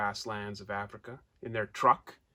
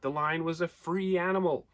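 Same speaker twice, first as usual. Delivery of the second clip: plain reading voice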